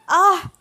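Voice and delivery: high cry of pain; short, high, loud yelp on falling; narrator's acted scream. A narrator's reading. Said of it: "అని గట్టిగా అరిచింది".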